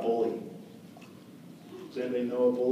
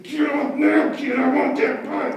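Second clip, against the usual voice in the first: much higher, louder hammed-up threat; mock scary bully; 10 semitones higher, 6 dB louder, slower than usual. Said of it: "I tried to sound as scared as I could".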